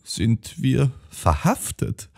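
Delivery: put-on slow, soft, fearful old lady's wail; a scared sister whimpering about arrest; ängstlich jammernd, leise und langsam; frightened whimper. A narrator's reading. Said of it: "jammerte Josephine, sichtlich von Angst".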